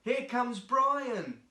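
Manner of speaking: loud, high, slow teasing call; shout. Acted. Child on the bus shouts teasingly as Brian arrives, loud and drawn out. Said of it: "Someone shouted".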